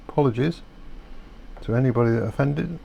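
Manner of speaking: ordinary talk, nothing marked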